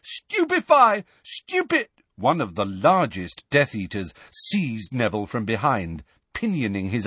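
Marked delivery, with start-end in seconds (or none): 0.0-1.9 high frantic yelling; shouted spell. A narrator's reading. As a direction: keep firing spells, frantic. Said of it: "Neville shouted again".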